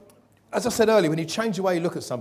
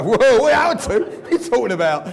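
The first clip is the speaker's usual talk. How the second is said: mock confused heckle; 7 semitones higher, 11 dB louder than usual. Audience member mocking the speaker's slip, loud baffled. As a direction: play a bewildered audience member. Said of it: "somebody go".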